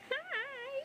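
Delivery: very high squealed greeting with a laugh. Real, not acted. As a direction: greet them with a delighted squeak. Greeting viewers joining the live.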